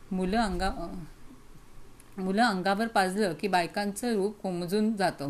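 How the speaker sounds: plain reading voice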